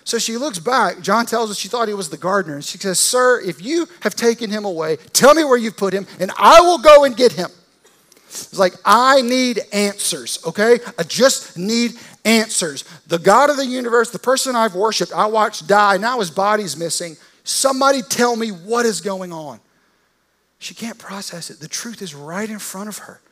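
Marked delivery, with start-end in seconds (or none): 3.0-7.5 high, loud, desperate demand; about to lose it. Acted. Woman confronting the supposed gardener, about to lose it. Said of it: "Mary's about to lose it". 8.8-19.6 loud, high, exasperated demand; losing it. Acted. Grieving follower demanding to know where the body is, losing it. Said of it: "Mary's about to lose it".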